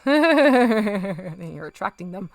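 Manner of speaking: loud, slow words through laughter; real laughter carrying the words; laughing. Real, not acted. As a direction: laugh out the line at the cats. Laughing at the cats by the paint.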